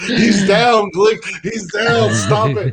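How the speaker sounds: hammed-up panic, begging Glick to stop; mock panicked call. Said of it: "Rocky's like".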